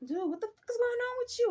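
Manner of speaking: mock scream of pain; mock yelling for murder. Stage manager attacked by ghost, hammed-up high yell. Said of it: "this man starts yelling damn near for bloody murder".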